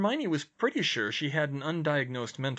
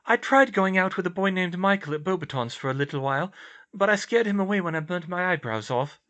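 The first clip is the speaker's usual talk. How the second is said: higher-pitched character voice, earnest; raised, sincere dialogue voice; earnest young heroine; 7 semitones higher, 6 dB louder than usual. Acted. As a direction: voice the concerned friend, pitched up.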